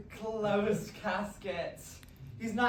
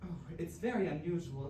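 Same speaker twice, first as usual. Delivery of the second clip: normal talking voice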